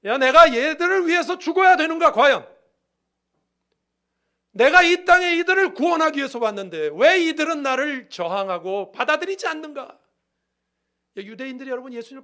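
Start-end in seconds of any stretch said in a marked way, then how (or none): 0.0-10.0 loud, high cry of despair; full-voiced, protesting outburst of grief; anguished lament. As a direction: wail it out as Jesus lamenting His rejection. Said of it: "예수님의 한탄입니다".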